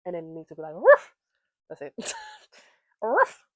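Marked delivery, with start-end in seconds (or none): 0.7-1.1 sudden loud, high woof; short, explosive imitation bark; dog bark. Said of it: "Why we barking now?". 3.0-3.5 sudden loud, high woof; short, explosive imitation bark; dog bark. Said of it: "Why we barking now?".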